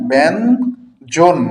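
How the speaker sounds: ব্যঞ্জন very slow and loud, split as ben-jon; demonstrated pronunciation. Shown as a demonstration. Teacher showing students the word, ব্যঞ্জন slowly as ben-jon. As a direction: stretch ব্যঞ্জন into two parts, ben, jon. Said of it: "এটা হচ্ছে ব্যঞ্জন".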